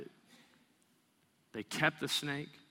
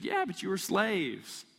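exasperated retort; 10 semitones higher, 6 dB louder than usual. Retold or imitated. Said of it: "Moses could have said".

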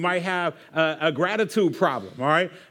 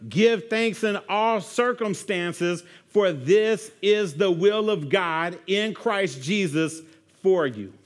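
slow scripture reading; slower than usual. Acted. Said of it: "Verse eighteen".

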